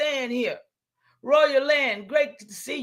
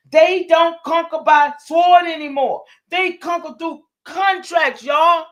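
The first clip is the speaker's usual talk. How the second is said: preaching shout; 4 semitones higher, 6 dB louder than usual. Real, not acted. Preaching climax to viewers.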